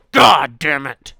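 loud, drawn-out curse; exasperated curse. Real, not acted. Host cursing in disgust, loud exasperated outburst. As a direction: burst out cursing, dragging it out.

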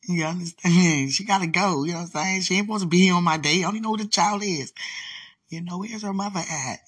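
loud, high, exasperated complaint; indignant outburst. Real, not acted. Complaining to the viewer about the baby on her date. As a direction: protest that she must go.

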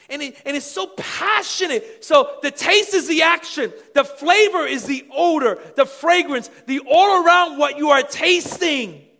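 loud, high, fervent preaching; preaching at full force. Acted. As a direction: drive it home to the congregation. Preaching on Psalm thirty-four.

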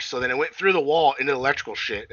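ordinary talk

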